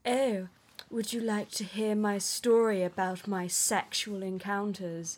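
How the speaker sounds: lightly put-on posh, French-tinged accent; a hint of posh French-accented English; posh, slightly French. Film heroine offering her story, lightly imitated posh French accent. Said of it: "I'm better at very posh, slightly French accents".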